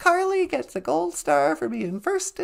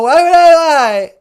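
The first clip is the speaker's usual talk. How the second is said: louder, hammed-up pleading denial; mock protest; 10 dB louder than usual. The caller denies lying to the host, mock protesting. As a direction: protest your innocence a bit too hard.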